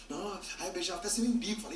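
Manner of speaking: ordinary delivery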